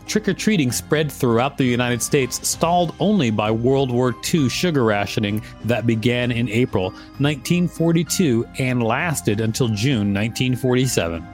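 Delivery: ordinary talk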